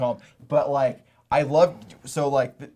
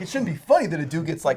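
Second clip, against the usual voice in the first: ordinary talk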